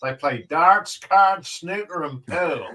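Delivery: punchy, loud northern Irish accent demo; harsh northern Irish accent. Shown as a demonstration. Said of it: "it's more of a very harsh".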